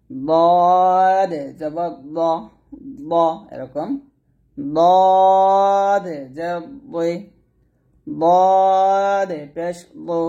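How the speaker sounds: slow, heavy drilling of each syllable; thick dad syllables. Shown as a demonstration. Teacher drilling the thick dad vowels for students. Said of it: "দদ মোটা উচ্চারণ করতে হবে".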